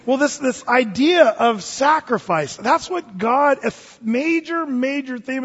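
slow down to land the big idea; slow, weighty emphasis. Real, not acted. Preacher turns from joke to sacrifice theme, slow and weighty. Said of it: "preacher slows to the point".

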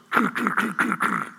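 mouth-made knock on the door; imitated knocking. Preacher mimics the neighbor knocking, clicky knock sound. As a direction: knock knock with the tongue.

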